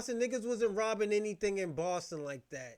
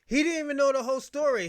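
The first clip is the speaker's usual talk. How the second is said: louder, higher, fast outburst; heated outburst; 6 semitones higher, 9 dB louder, faster than usual. Real, not acted. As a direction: snap it out in frustration. Angry rant about documentary.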